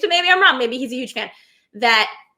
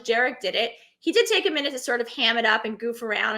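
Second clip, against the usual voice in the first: ordinary talk, nothing marked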